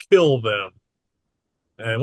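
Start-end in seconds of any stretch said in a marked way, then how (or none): 0.1-0.7 sinister voice. Acted. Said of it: "he says in a very sinister voice".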